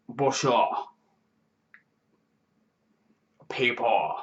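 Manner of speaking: clumsy mock French accent on a greeting; a failed stab at an accent that lands on French; bad French accent. YouTuber asked for an American accent tries a French one instead. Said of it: "like, more French".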